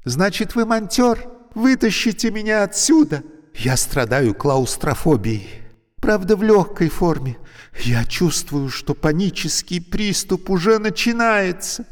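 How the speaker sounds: high, loud panicky pleading; a claustrophobic woman on the edge of panic; anxious trapped woman. A narrator's reading. Trapped woman begging the stranger to get her out. Alevtina's voice in story.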